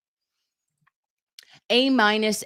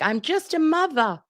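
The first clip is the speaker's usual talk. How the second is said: much higher, prissy mocking quote; pearl-clutching mock mother voice; mock innocent mother; 11 semitones higher than usual. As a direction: play the innocent mum, sing-song. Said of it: "And that you were like".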